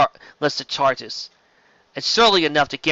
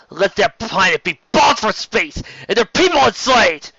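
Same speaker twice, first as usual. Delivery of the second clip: higher, louder, rising fury; vengeful outburst; 7 semitones higher, 5 dB louder than usual. Acted. Sheridan demanding revenge on his betrayers, rising anger. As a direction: let the rage build and spill.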